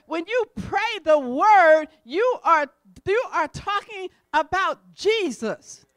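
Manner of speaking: loud, slow, hammered-out preaching; forceful preaching. Acted. Preacher asking the church who is the Word, preaching forcefully. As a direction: drive each phrase home to the congregation.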